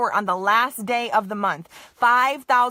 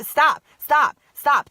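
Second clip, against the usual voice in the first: loud repeated stop commands; forceful stop; 2 semitones higher, 7 dB louder, much slower than usual. Shown as a demonstration. Coaching Sonia to silence her doubts, loud and forceful. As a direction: bark stop at your own negative thoughts. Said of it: "Put your hand in front of your face and literally do it".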